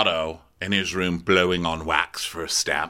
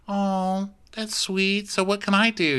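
sweet daughter; 10 semitones higher than usual. Retold or imitated. Daughter touched by her father's words, high and sweet. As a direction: answer sweetly, pitched well up.

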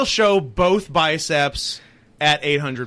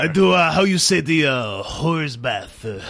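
put-on French Canadian accent. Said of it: "He's us doing a French Canadian accent".

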